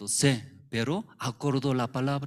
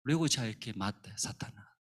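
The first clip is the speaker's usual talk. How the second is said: normal talking voice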